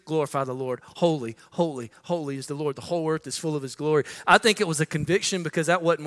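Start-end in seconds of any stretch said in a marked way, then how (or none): 0.0-4.0 much softer, hushed worshipful chant; reverent hush of the beasts. Acted. Heavenly beasts softly praising the Lord, hushed. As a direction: breathe it like awed praise. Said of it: "using their lips to do".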